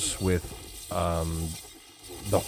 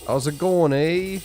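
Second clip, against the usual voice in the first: higher, louder Canadian "How's it going, eh?"; Canadian accent demo; 10 semitones higher, 5 dB louder than usual. Shown as a demonstration. Showing off his Western Canadian accent to a viewer, higher and louder. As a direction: toss off a big Canadian "eh". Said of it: "We still use the A in Western Canada".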